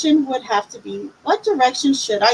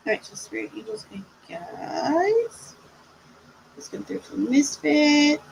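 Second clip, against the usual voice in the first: slow, hushed spirit invocation; 4 dB quieter than usual. Acted. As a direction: breathe out the call to spirits. Calls on spirit guides.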